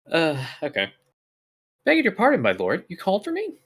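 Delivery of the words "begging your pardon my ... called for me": high, eager maid voice; a bright, bubbly young lass greeting her lord; peppy young woman. Acted. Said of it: "what kind of voice does Ellie have?".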